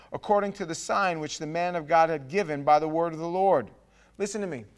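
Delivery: ordinary talk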